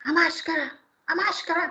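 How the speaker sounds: gruff demanding mock man; rude man barking. Preacher imitating a rude man on the metro demanding the mask. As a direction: snap out the demand. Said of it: "Parecia ... ladrão falando".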